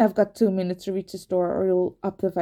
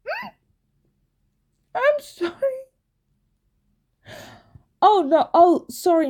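whimpering; 9 semitones higher than usual. Acted. Crying apology.

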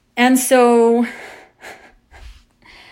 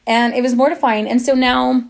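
normal talking voice

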